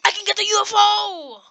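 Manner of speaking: gasp, then high excited shout; thrilled about a big find. Real, not acted. Excited discovery of points.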